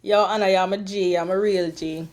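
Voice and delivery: ordinary delivery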